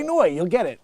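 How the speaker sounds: ordinary talk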